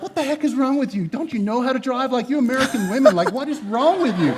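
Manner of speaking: hammed-up exasperated husband, laughing through it; mock angry rant. Husband showing how he could have yelled at his wife, mock angry. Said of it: "It wouldn't have gone well".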